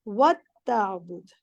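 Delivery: "व ताबुद" very slow, the ta doubled; slow model reading. Shown as a demonstration. Teacher models the correct form slowly for the student. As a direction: drag out "व ताबुद" clearly. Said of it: "आप गौर से सुने".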